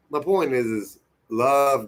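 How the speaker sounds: ordinary talk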